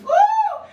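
long, very high "ooh"; high whoop. Real, not acted. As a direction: let out a preacher's whoop. Preacher whoops mid-sermon.

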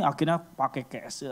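usual speaking voice

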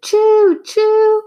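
very high, loud choo choo; train whistle. A narrator's reading.

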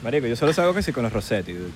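low, quick Valencia bro; snobby parody of Valencia, Venezuela; Valencia, Venezuela accent. Acted. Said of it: "Unos de Valencia". (acento valenciano venezolano)

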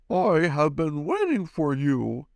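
wise octopus deep voice. Acted. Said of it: "with a deep voice".

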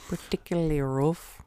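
put-on loose, doubtful Irish accent; attempted Irish. Co-host playing along with the bit, attempted Irish. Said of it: "Irish? I have no idea".

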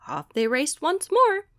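plain reading voice

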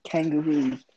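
lightly put-on Australian with British mixed in; Australian accent. Shown as a demonstration. Showing co-host a British-Australian mix, amateur Australian accent. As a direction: attempt a rough Australian accent, word by word. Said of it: "mix my British accent up with an Australian accent".

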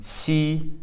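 xi held high and level; smiling consonant demo. Shown as a demonstration. Teacher drilling smiling consonants, demonstrating xi. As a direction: say xi with lips stretched wide. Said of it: "you're pronouncing".

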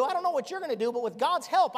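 loud, high-pitched revival preaching; preaching at full force. Acted. Preacher thundering at the congregation, full force.

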